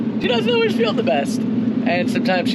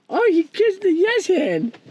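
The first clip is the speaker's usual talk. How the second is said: much higher, delighted praise; excited dog talk; 11 semitones higher than usual. Real, not acted. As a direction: gush over the dog.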